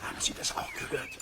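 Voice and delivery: hushed, alarmed whisper. Acted. Neighbour hearing a howl, asking Peter in a hushed whisper. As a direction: breathe it so nothing outside hears.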